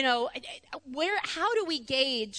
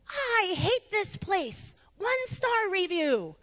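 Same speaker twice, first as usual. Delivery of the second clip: put-on high, slow sulky child voice; slow high pouty kid complaint; pouting toddler; 7 semitones higher, much slower than usual. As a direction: whine it like a hurt three-year-old. Crying angry toddler quoted.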